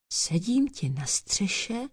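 soft, slow storytelling whisper. A narrator's reading. Cat tells the dog of her night watch, whispering. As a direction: confide it under your breath. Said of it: "vypravovala Jura šeptem".

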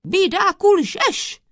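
high, loud furious shout; explosive outburst from the judge. A narrator's reading. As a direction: shout the accused down. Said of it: "rozkřikl se na něj soudce".

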